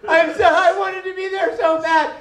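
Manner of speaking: high, wailing mock sob; crying on roof. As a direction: plead tearfully at the top of the voice. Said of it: "I'm sitting on my roof crying".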